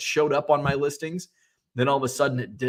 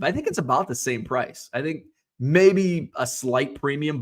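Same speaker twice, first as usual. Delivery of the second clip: usual speaking voice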